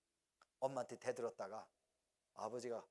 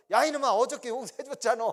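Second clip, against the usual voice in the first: loud, higher hammed-up fatherly telling-off; louder mock rebuke to a child; mock scolding father; 9 semitones higher, 16 dB louder than usual. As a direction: play an exasperated dad, for laughs. Father mock scolding repeat offender.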